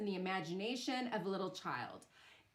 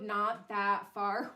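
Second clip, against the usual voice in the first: normal talking voice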